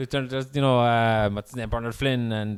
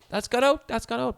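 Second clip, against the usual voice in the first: high-pitched mock goalie shout; 12 semitones higher than usual. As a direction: yell it's out to the ref. Goalie calling the ball out.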